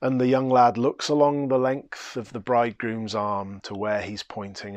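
normal talking voice

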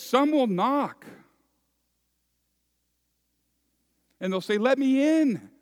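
loud, high, ringing pulpit voice; preaching at full force. Acted. Preacher to his congregation, at full force. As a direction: proclaim it to the whole congregation.

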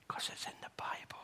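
breathy conspiratorial whisper; a hushed joke under the breath; whispered aside. Real, not acted. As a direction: let them in on a secret. Joking aside to congregation.

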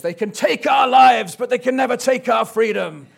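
put-on loud, high rallying cry in a Scottish accent; William Wallace. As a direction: shout it like a Scottish rebel rousing troops. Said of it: "You know, William Wallace style ... Sorry about my Scottish accent".

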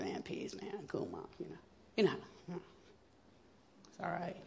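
soft, lazy stoned murmur; mumbled hippie brush-off. Retold or imitated. Stoned daughter brushing off her mother, soft murmur.

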